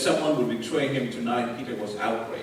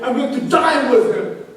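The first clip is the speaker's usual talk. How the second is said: higher, louder cry of guilt; anguished remorse; 11 semitones higher, 11 dB louder than usual. Acted. Peter lamenting his broken oath, higher and louder in anguish. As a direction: wail it out as if the guilt is crushing you.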